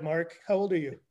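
normal talking voice

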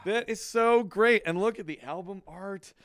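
high, stretched-out gushing; delighted. Real, not acted. Admiring surprise record gift.

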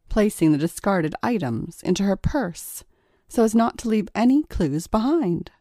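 ordinary delivery, nothing marked

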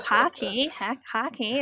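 playful mock Minnesota accent. Australian guest echoing the host's word hockey, mock Minnesota accent. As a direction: have a go at a hammed-up Minnesota accent. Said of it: "I won't do an accent again".

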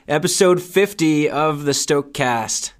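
upbeat podcast host welcome; host intro. Acted. Host restarting the episode fifty intro, upbeat announcer read. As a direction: restart the show open brightly. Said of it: "restarting the episode intro".

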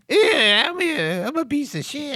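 high, groveling servile voice; servile toady voice. Retold or imitated. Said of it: "when I do your voice".

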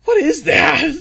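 high loud disbelief; incredulous. Real, not acted. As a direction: scoff at the ugly jacket. Reacting to ugly jacket.

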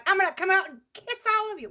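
high, fast mock politician shout; mocking rally voice. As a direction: ham up a rally promise. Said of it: "he was at the rally going".

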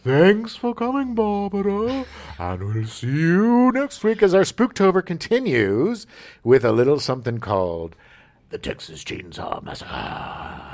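announcer voice. Pretend. Mock show sign-off.

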